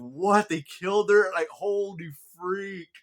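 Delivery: high, loud stunned outburst, slowing at the end; shocked disbelief. Retold or imitated. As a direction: blurt it out as if you can't believe they killed her. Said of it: "I was like".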